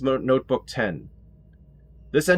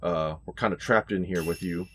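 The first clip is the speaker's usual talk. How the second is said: normal talking voice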